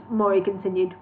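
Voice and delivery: usual speaking voice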